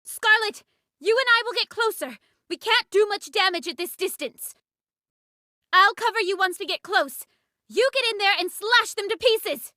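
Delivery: rally the squad into the charge; battle orders. Acted. Commander giving battle orders.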